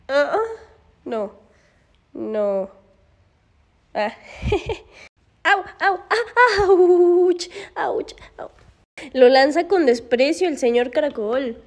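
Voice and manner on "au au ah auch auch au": laugh-tinged high ouches; pained ows. Real, not acted. Woman bitten during play, laughing yelps of pain. Dog biting her.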